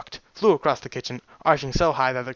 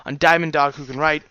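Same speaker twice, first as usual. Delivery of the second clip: plain reading voice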